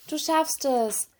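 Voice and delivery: high, drawn-out encouragement; cheering on. A narrator's reading. Little mermaid to the tired baby turtle, cheering it on. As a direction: cheer on someone small, stretching the words. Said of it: "feuerte Serena das Baby an".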